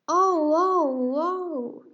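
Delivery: high, loud cries of dismay; loud panicked king; startled yell. Acted. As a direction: yell as the glue hits. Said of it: "he yelled".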